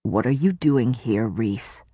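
low, hard question; a touch of a stern demand. A narrator's reading. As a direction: demand an answer. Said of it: "Tamlin demanded".

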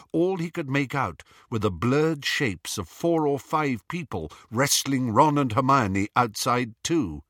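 ordinary delivery, nothing marked

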